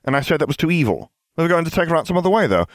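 rapid, high-pitched protest; insistent. A narrator's reading. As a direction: rattle it off as if correcting the record. Recounting a dispute, insisting.

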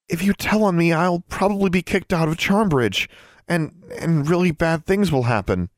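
high, desperate appeal; desperate pleading. A narrator's reading. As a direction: beg as if on the verge of tears. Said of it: "trying to sound desperate".

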